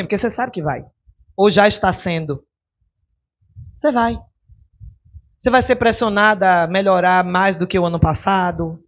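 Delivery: ordinary talk, nothing marked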